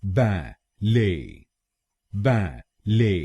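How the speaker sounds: बैले very slowly, split as बै, ले; slow American syllable demo of बैले. Shown as a demonstration. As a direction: pause between "ba" and "lay" in बैले. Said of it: "पहले शब्दांश को यानी B ए".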